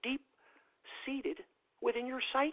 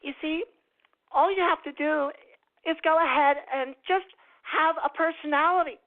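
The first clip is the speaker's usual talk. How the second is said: higher, louder put-on character voice; high mock voice; 6 semitones higher, 11 dB louder than usual. Mock character cheerfully lecturing listeners, high loud voice.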